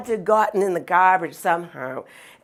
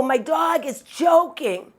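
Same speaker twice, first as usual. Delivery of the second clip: higher, slow pleading call; urgent shout; 6 semitones higher than usual. Retold or imitated. Owner calls out slowly to the vet about her choking dog. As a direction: yell it slowly so the doctor hears. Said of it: "I go".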